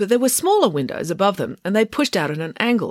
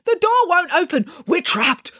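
high, panicked wail; frantic cry, pitched right up; 13 semitones higher than usual. A narrator's reading. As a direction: wail it as if trapped. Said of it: "wailed April".

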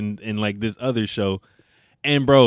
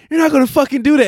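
high, louder protest; mock panicked yell; 8 dB louder, faster than usual. Boyfriend yelling at girlfriend over his cat, high and loud. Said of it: "He was like".

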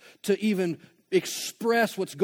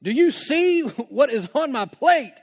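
exasperated protest; 8 semitones higher, 6 dB louder than usual. Pretend. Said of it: "you're like".

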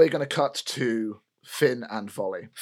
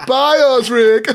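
mocking goodbye; 10 semitones higher, 9 dB louder, slower than usual.

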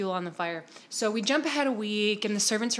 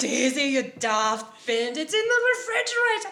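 exasperated Missus Padmore; 7 semitones higher, 6 dB louder than usual. Acted. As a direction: play a flustered cook yelling at her helper.

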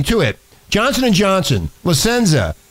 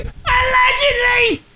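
high, slow squeal on allegedly; mocking squeal; much slower than usual. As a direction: shriek the word allegedly up high. Mocking the news word.

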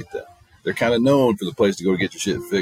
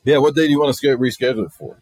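mechanic's offhand reply; 6 semitones higher, 6 dB louder, faster than usual. Retold or imitated. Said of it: "He goes".

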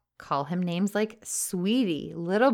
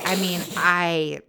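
ordinary talk, nothing marked

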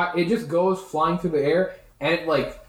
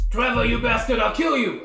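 higher-pitched creature yell; angry Grung threat; 6 semitones higher than usual. Acted. Game master as the Grung threatening Trevor, angry yell. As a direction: threaten him in a raised, put-on voice. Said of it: "it's like".

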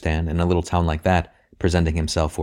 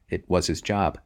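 usual speaking voice

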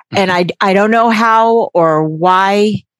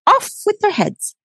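mock queen's decree; 13 semitones higher than usual. Mock royal decree, high.

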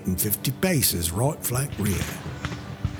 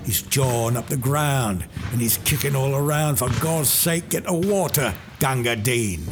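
higher, louder pleading cries; desperate calling; 7 semitones higher, 4 dB louder than usual. Acted. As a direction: shout for help for the wounded man. Calling for water for wounded.

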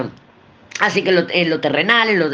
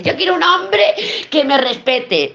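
higher, put-on voice of a client; voiced client; 8 semitones higher than usual. Tarot reader voicing a client who wants respect, higher put-on voice. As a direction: play the client stating her demand.

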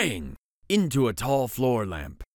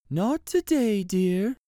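higher, gently weary mother voice; sighing mother; 9 semitones higher, slower than usual. Acted. Mother refusing her daughter at the store, lightly voiced sigh. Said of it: "her mother sighed".

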